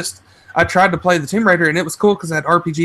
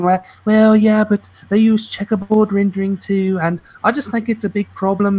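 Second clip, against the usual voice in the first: higher-pitched put-on critic voice; mock nitpicking reviewer; 4 semitones higher than usual. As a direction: imitate a fussy critic making excuses. Mimics a nitpicking reviewer's complaint.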